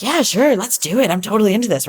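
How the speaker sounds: high keen yeah sure; a light mock of eager enthusiasm; eager agreement. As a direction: sound totally up for it.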